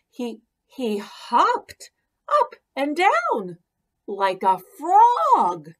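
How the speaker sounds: playful springy storytelling; bouncy excited telling. A narrator's reading. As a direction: bounce each word like a hopping frog. Narrator bounces with delight.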